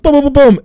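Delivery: loud, high mouth gunfire; gunfire noises. Retold or imitated. Retelling Ryan shooting, loud playful gun noises. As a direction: blast out mock gun sounds. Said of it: "Ryan runs out and is like".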